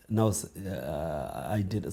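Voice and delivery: low, soft, slow halting denial; nervous mumble. Retold or imitated. Caught boy to the principal, soft hesitant mumble.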